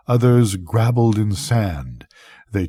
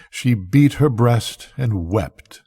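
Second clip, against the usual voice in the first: ordinary delivery, nothing marked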